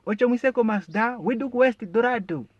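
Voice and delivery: high, loud taxi tout call; shouting for passengers. Shown as a demonstration. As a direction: hawk the destinations like a tout. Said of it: "where you can find me shouting".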